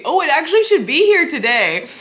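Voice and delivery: high, loud burst of excitement; excited interjection. Real, not acted. Vlogger suddenly remembering the new piano book's arrival, excited.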